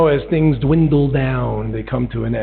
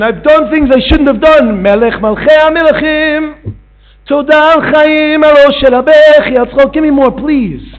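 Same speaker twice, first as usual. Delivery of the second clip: high, loud desperate pleading; frantic confession to the king; begging servant; 13 semitones higher, 7 dB louder than usual. Acted. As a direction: plead for your life before the king. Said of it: "he begs for his life".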